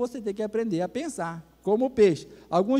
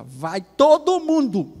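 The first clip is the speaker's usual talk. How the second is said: loud, slow, higher-pitched command; table-banging matriarch; 5 semitones higher, 7 dB louder, much slower than usual. Retold or imitated. Grandmother overrules the father at the table, loud command. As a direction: lay down the law, stretching each word. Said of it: "Aí ela bateu na mesa".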